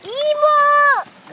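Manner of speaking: long drawn-out call to aunt. Real, not acted. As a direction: stretch the call out loud. Child calls out to aunt.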